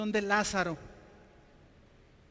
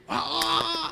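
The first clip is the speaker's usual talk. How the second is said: stagey exclamation; 8 semitones higher, 6 dB louder than usual. Retold or imitated. Stone actor's big moment.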